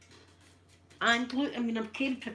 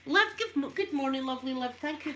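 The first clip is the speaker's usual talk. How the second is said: much higher, louder happy hello; light up at a friend arriving; delighted greeting; 6 semitones higher, 5 dB louder than usual. Real, not acted. Welcoming viewer Liv into the chat, delighted high greeting.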